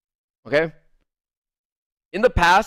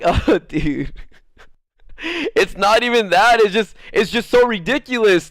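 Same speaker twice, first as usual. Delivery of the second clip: high, loud, laughing through the words; can't stop laughing at how ridiculous it is; laughing while talking; 6 semitones higher, 5 dB louder than usual. Real, not acted. Telling friends about the fight, laughing high and loud. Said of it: "I started laughing".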